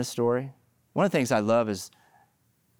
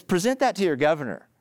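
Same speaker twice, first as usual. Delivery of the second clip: higher, mocking challenge to the people; sarcastic; 6 semitones higher than usual. Prophet Malachi mocking Israel's offerings, sarcastic. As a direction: dare them, dripping with irony. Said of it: "It's almost sarcastic".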